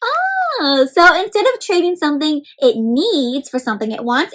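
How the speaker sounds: ordinary delivery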